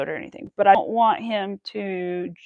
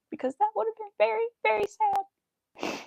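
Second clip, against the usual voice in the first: mock sad; 12 semitones higher, 4 dB quieter than usual. Real, not acted. High slow sad voice.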